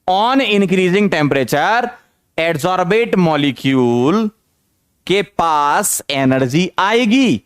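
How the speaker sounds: very slow, word-by-word note dictation; dictating a line for the notebook, drawn out. Shown as a demonstration. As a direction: spell it out slowly so they can write. Dictating notes to students.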